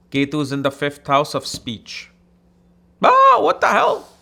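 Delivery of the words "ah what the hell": sudden squeal, much higher and louder; mock bafflement. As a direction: burst out like a puzzled viewer. Voicing a baffled listener.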